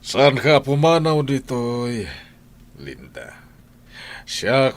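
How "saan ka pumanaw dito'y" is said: loud, high scolding demand; angry shout. Acted. Boss shouting at the maid about leaving. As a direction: bark it at the maid. Employer confronting maid Linda.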